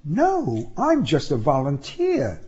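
a hint of a young woman; woman's voice. A narrator's reading. Woman's line in argument.

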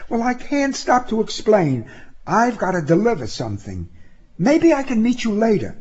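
put-on high-pitched young woman putting someone off; high, pressed, apologetic woman's voice; Sybil, hurried. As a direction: excuse yourself in a high voice.

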